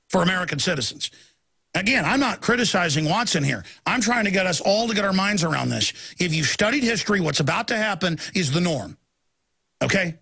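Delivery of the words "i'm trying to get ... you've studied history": usual speaking voice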